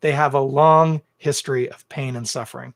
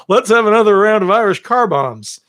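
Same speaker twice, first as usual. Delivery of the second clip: loud, higher, jeering bro voice; mocking party drinker; 7 semitones higher, 7 dB louder than usual. Mocking careless drinkers ordering rounds, loud jeering voice. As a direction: sneer it like a rowdy barfly ordering rounds. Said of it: "hearing people be like".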